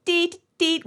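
high-pitched electronic "deet"; short high beeps; imitated beep. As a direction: mimic a buzzer going off with your voice. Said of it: "it beeps".

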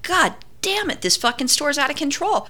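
exasperated self-quote; retold frustration. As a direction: complain high without shouting. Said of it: "I'm so frustrated".

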